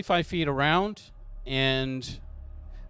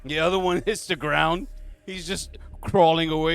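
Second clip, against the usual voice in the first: ordinary delivery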